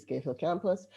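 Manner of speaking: normal talking voice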